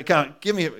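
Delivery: normal talking voice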